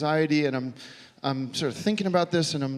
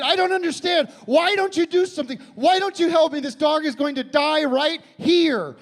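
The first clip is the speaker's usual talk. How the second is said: much higher, louder frantic plea, slowing at the end; retold desperate yell; 12 semitones higher, 5 dB louder than usual. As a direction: yell at her to save the dog. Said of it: "I said".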